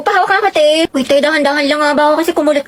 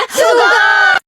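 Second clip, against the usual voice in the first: loud, higher rallying yell; battle cry; 5 semitones higher, 3 dB louder than usual. Acted. Leader yelling to rally classmates before they perform. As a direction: fire the class up.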